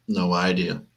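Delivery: ordinary talk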